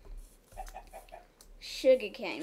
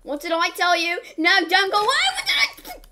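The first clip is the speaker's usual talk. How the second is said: higher, louder, laughing telling-off; laughing mock yell; 7 semitones higher, 9 dB louder, faster than usual. Calling after a fleeing game animal, laughing yell.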